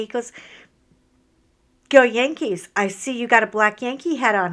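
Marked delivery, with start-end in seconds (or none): 1.9-4.5 loud fan cheer; mock cheer. Young fan cheering at her, loud. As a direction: shout it like a fan at the game.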